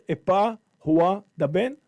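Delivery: Epa Hoa Daben, loud and careful; sounding out words. Shown as a demonstration. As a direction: Epa Hoa Daben said whole, each part clear. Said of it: "Now together".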